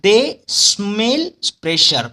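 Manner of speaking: slow, with gaps between दे, smell and pressure; word-by-word mnemonic, दे / smell / pressure; memory-phrase demo. Shown as a demonstration. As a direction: punch out दे, smell, pressure one by one. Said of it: "तो इसे याद कैसे रखोगे".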